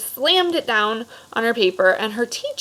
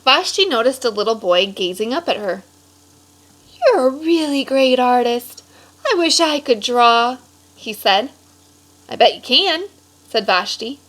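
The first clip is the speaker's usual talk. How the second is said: lively storybook read-aloud voice; animated picture-book narration; reading to children; 3 semitones higher than usual. A narrator's reading. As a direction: read it like story time for a class.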